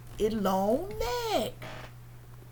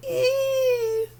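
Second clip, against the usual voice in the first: long high elephant squeal; imitated trumpeting on a held "ee"; 13 semitones higher than usual.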